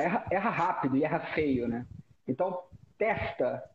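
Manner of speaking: ordinary delivery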